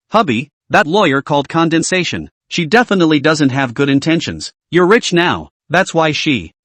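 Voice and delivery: ordinary delivery, nothing marked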